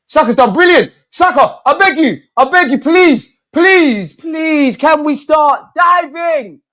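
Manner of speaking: high, loud pleading, begging the screen; desperate begging. Real, not acted. Fan begging Saka through the screen, high loud pleading. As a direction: plead at full voice for one moment of magic.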